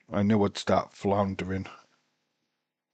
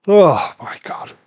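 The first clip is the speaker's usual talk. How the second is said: much louder and higher, slowly stretched out; shocked exclamation; 8 semitones higher, 14 dB louder than usual. Real, not acted. Fan reacting to a violent spot, loudly shocked. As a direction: gasp it out in disbelief.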